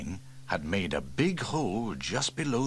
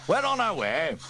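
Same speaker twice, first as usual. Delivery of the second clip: Steele voiced much higher, firm; reassuring chief; 14 semitones higher, 5 dB louder than usual. Fire chief reassures the caller on the phone, firmly.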